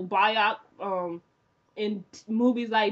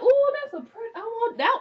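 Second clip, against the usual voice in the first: high, gushing ooh over a dress; excited gushing; 11 semitones higher than usual. Retold or imitated. Fan gushing over the wedding dress, high and excited. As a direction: squeal like you just saw the dream dress. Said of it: "I'm like".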